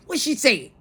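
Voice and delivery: high, loud imitated demand; retold taking charge. Daughter taking charge, demanding answers, retold high and loud. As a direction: demand it as she did, voice jumping up. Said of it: "She took charge".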